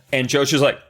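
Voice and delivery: ordinary delivery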